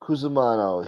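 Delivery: ordinary talk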